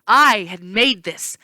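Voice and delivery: plain reading voice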